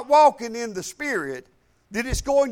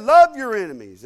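much louder, punched Bible quote; emphatic command; 2 semitones higher, 10 dB louder than usual. Retold or imitated. Preacher quotes Jesus to the congregation, loud emphatic command. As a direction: proclaim it as a firm command.

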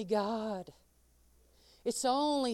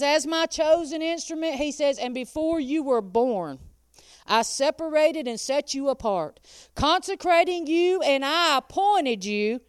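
louder, slow, weighty God's words; preaching scripture; 3 semitones higher, 7 dB louder than usual. Acted. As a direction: proclaim each phrase.

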